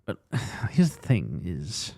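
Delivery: slow, sighing halting impression; sigh, then drag it out hesitantly; Hugh Grant. Mocking how he walks into scenes, slow sighing voice. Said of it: "The way you fucking talk".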